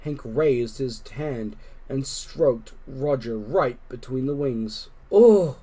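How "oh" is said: loud high yowl of delight; cat sound. A narrator's reading. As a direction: call out like a pleased cat. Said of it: "James said".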